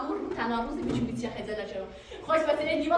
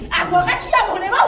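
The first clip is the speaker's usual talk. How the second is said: loud, raised challenge; exasperated outburst; 4 semitones higher, 8 dB louder than usual. Real, not acted. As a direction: throw the question out loud and high. Outburst at end of quarrel. (با کلافگی و صدای بلند)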